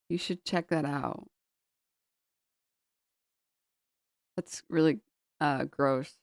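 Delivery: quiet, hesitant, long awkward pauses; imitated grossed-out unease. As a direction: gently break awkward news, halting. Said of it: "like, grossed out".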